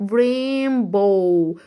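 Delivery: very slow "رينبو" stretched out; drawn-out demo. Shown as a demonstration. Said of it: "فهقول رين".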